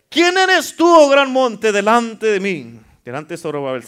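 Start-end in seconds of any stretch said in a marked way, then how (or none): 0.1-2.8 high, defiant declamation; indignant. Shown as a demonstration. Preacher challenging the mountain before him, indignant. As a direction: start high and forceful, then stretch the last words slowly. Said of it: "dígalo como que ya se indignó de esa montaña".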